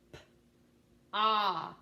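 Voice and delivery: "P" as a puff, then long "Ah"; sounded out. Shown as a demonstration. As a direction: separate the sounds "P" and "Ah". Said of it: "Tell me the sounds in pod".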